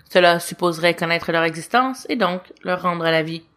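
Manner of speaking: usual speaking voice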